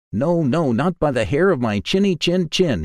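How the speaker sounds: high, squeaky little pig; a small pig standing firm, pitched high; high squeaky piglet refusing. A narrator's reading.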